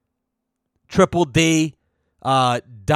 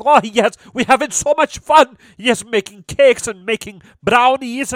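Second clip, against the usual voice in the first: imitating the Dutch contestant; 8 semitones higher than usual. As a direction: ham up a chirpy Dutch guy gushing about cakes. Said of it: "like a little, like, Dutch guy".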